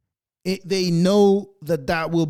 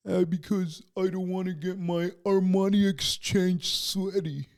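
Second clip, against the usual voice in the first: softer, mocking spoiled rich kid; snobby rich kid; 5 dB quieter than usual. Vlogger mocking rich kids who play tennis, prissy. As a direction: sneer it like a pampered brat. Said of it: "they call it a sport because they didn't wanna sweat".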